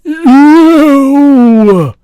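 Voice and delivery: very high, loud drawn-out howl; giant's roar. A narrator's reading. Sleeping giant roars as the nightmare starts, huge howl. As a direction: roar out in high-pitched agony. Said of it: "roared the flesh lump eater".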